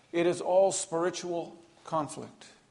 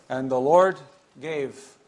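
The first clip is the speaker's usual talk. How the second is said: "gave" set apart by pauses on both sides; stress on gave; 2 semitones lower, 4 dB louder, slower than usual. Shown as a demonstration. Preacher reading scripture to show who acted, stress on "gave" by pausing around it. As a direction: let "gave" stand alone after a beat, firmly placed without extra volume. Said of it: "emphasis on the word gave".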